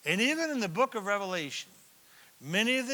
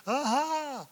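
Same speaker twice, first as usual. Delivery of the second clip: triumphant aha; 9 semitones higher than usual. Retold or imitated. Detective finds the culprit.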